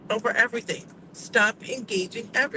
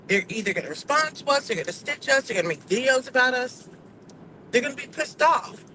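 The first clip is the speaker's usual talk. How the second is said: ordinary talk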